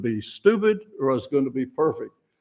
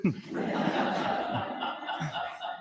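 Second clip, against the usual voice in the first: soft unvoiced laugh; little airy laugh; breathy laugh; 10 dB quieter than usual. Real, not acted. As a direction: laugh quietly at the joke. Laughs at own joke.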